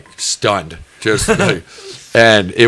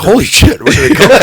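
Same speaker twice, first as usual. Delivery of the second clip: high, loud comic shock; retold startled surprise; 11 semitones higher, 6 dB louder than usual. Buddy suddenly noticing the kids below, mock startled outburst. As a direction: exclaim it as if they popped from nowhere.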